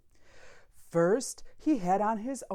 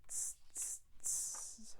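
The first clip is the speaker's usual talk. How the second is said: soft unvoiced hissing tss sounds; monkey tsk; 17 dB quieter, slower than usual. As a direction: tut back like a cheeky monkey.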